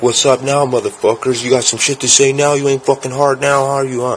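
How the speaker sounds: high, goading challenge; menacing taunt. Retold or imitated. Gunman to group on porch, high-pitched aggressive taunting. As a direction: imitate a cocky aggressor daring them.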